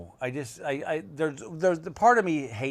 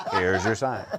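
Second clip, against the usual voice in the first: punchline delivery; 3 semitones lower, 4 dB louder, slower than usual. Acted. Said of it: "one of my Here's Your Sign jokes".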